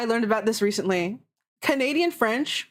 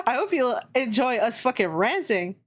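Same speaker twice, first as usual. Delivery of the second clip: ordinary talk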